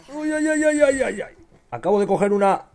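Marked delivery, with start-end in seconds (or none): 0.1-1.4 very high, loud string of yelps; yelping ay ay. Real, not acted. Gamer yelping under fire, high squeals. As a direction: squeal each ay in alarm. Hurt or alarmed in game.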